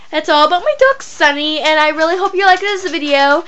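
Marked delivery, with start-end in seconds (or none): none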